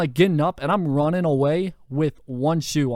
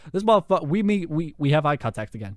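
ordinary talk

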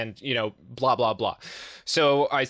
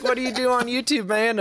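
high mock cop voice, much higher; mimicking the cop; 12 semitones higher than usual. State cop asking about YouTube, high mock voice. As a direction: squeak the question like a baffled old cop. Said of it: "He's like".

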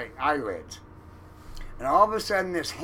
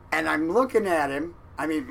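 ordinary talk

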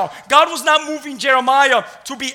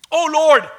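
loud, raised plea; crying out to God; 5 semitones higher, 7 dB louder than usual. Acted. Preacher acts out Jeremiah's prayer, crying out. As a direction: cry it out to heaven. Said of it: "cried out to the Lord like that".

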